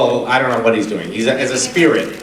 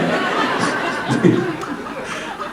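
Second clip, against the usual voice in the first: high breathy laugh; 13 semitones higher, 7 dB quieter than usual. Real, not acted. Laughs at own joke.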